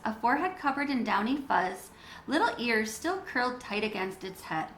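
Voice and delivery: ordinary delivery, nothing marked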